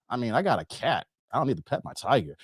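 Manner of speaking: low, soft, deadpan reply; unimpressed homeowner. Acted. Dave to the brochure pitch, low and unimpressed. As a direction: drop it low, not buying it.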